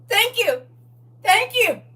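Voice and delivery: high robotic game character; NPC voice. Pretend. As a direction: chirp it like an NPC. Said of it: "my version of the N P C".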